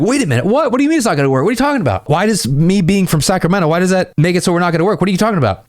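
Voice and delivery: high, incredulous woman protesting; hammed-up offended disbelief; mock indignant protest. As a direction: pile up baffled questions, pitched high. Acted woman protesting a teasing rejection.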